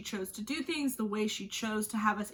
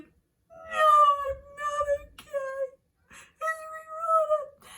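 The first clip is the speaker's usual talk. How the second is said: high, wailing mock sob; imitating her sobbing; 6 dB louder, slower than usual. Answering her worried partner, hammed-up high sobbing. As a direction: whimper it out like a crying mess over a book. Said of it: "He, like, heard me crying".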